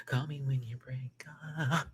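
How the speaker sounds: breathy unvoiced whisper of the title. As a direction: whisper it like a secret aside.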